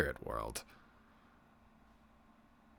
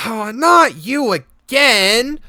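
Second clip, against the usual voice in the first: put-on very high, loud, slow whiny child; annoyed little girl; 15 dB louder, much slower than usual. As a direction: groan it like a sulky kid. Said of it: "She goes".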